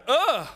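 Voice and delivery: high, loud grossed-out ugh; mock disgust noise; disgusted grunt. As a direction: recoil like a squeamish clerk. Store worker recoils in disgust.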